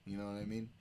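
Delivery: normal talking voice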